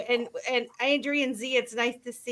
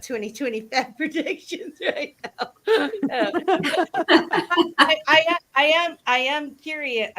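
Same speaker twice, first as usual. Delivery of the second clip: laughing; 5 semitones higher, 4 dB louder than usual. Real, not acted. Laughing at own comment.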